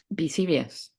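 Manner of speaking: suddenly earnest; serious. Real, not acted. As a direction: say it gravely and simply. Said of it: "as I've just turned serious".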